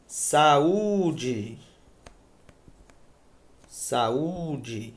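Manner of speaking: "Saúde" with the Ú held long; stressed U. Shown as a demonstration. Teacher showing the tonic syllable in a hiatus, stressed and drawn-out U. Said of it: "Sílaba tônica, U".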